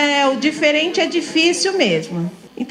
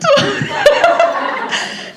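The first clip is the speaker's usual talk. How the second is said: a bright burst of laughter, much higher. Real, not acted. Teacher laughing at her own praise, high laugh. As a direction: laugh at your own remark.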